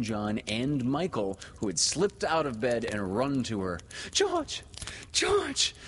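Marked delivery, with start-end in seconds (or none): 4.1-5.7 very high woman's call; overcome cry. A narrator's reading. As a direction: call out high, overwhelmed with joy. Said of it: "she cried when she could speak".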